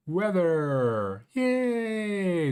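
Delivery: drawn-out, high-pitched fanfare for the forecast; put-on show-opener cheer; mock cheer. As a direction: stretch it out into a high playful "yay". Mock fanfare introducing weather display.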